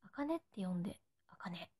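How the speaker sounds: whispered softly, no voice at all; soft unvoiced whisper of あかねって呼んで; breathy whisper. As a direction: breathe it like a secret to a fan. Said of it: "あかねって呼んでってこと？".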